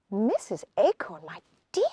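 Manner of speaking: ordinary delivery, nothing marked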